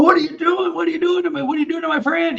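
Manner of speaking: very high, strained pleading yell; reenacted screaming protest. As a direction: yell frantically for your friend, in retelling. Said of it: "I'm screaming and yelling".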